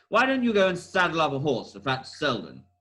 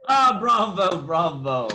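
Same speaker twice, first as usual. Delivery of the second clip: cheering bravo; 3 semitones higher, 5 dB louder, slower than usual. Acted. Sherlock applauding Sir Henry.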